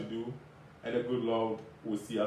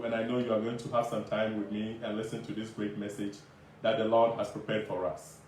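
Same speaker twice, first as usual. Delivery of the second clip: ordinary talk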